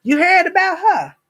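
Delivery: imitating gossipy sister. Imitating her sister Angela gossiping, high mock voice. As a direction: play the nosy sister fishing for news. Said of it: "You just want".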